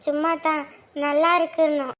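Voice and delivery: usual speaking voice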